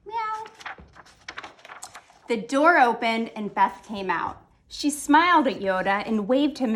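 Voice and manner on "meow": soft kitten meow. A narrator's reading. Kitten meow behind door.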